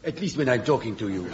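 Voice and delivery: usual speaking voice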